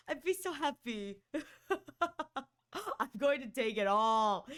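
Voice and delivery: ordinary talk, nothing marked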